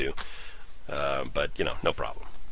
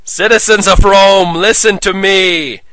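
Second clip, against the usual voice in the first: loud, slow, ringing address; booming proclamation; 9 semitones higher, 10 dB louder, slower than usual. Acted. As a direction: proclaim it across the arena. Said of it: "his powerful voice rang out throughout the arena".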